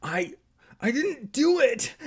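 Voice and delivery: high, loud panicky denial; stammer, then squeal the denial; sputtering protest. Acted. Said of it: "Evan sputtered".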